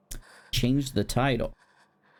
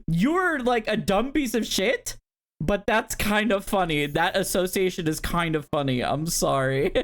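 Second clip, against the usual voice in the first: laughing reaction; 6 semitones higher than usual. Retold or imitated. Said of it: "it was like".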